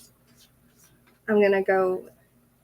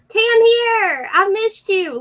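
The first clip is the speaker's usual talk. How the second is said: much higher and much louder, bursting with joy; excited greeting; 11 semitones higher, 8 dB louder, slower than usual. Real, not acted. Excited greeting of a returning viewer.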